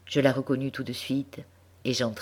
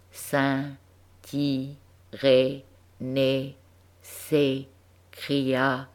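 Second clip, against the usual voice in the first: put-on child sounding out each syllable, slow and separated; a hint of a schoolgirl spelling out words aloud; halting child reading; much slower than usual. A narrator's reading. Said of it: "qui lisait en s'arrêtant à chaque syllabe".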